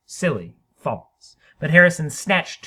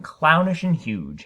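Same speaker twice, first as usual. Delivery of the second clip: plain reading voice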